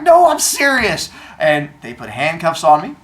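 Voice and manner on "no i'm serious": high, loud, drawn-out insistence; earnest protest, retold. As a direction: plead loudly to be believed. Insists to disbelieving officer.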